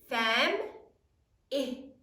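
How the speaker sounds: "Fam-i-ly" stretched slowly, each syllable drawn out; slow sounding out. Shown as a demonstration. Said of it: "Sound it out".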